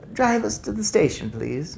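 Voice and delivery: put-on prim, firm old lady. Grandmother silencing the chatty driver, prim. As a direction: cut him off primly. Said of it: "looking prim".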